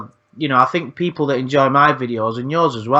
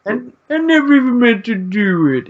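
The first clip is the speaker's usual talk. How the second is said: whiny mock excuse, pitched much higher; mocking Lorne; 9 semitones higher than usual. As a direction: plead your innocence in a silly put-on voice. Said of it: "every time I hear that, I laugh".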